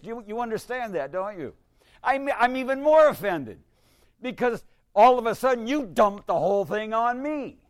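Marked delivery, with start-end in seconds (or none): 2.0-7.6 mock offense. Acting offended parishioner, laughing.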